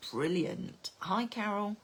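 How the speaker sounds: ordinary delivery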